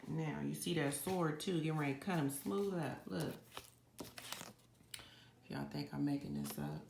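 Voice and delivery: normal talking voice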